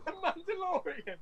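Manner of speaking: high, slow silly voice on "The Mandalorian"; hammed-up high-pitched joke; squeaky mock correction. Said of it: "flew off in the Mandalorian".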